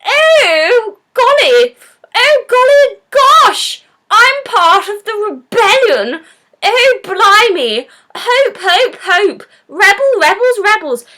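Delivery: high, loud, hammed-up posh heroine; mock a posh rebel with swooping high pitch; caricatured posh lady. Posh lady joining the rebellion, gushing high mock voice. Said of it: "she's just like a radical posh lady".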